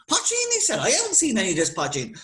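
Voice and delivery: squeaky shocked high poteen; quick, greedy surprise; surprised. Acted. Boyo surprised by the news.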